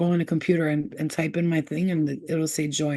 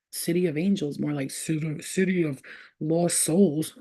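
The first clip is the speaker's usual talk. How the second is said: normal talking voice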